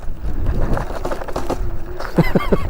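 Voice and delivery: airy high laugh; breathy laugh. Real, not acted. Laughing on bumpy ride.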